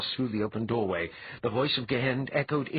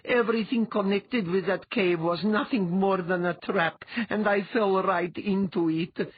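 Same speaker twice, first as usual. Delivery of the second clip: high-pitched, distraught outpouring; sobbing; 10 semitones higher, 3 dB louder than usual. A narrator's reading. Letha tells Dwea of the trap, sobbing. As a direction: pour it out in despair, pitched up. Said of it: "Letha sobbed".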